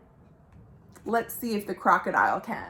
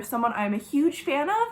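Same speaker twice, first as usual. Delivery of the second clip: usual speaking voice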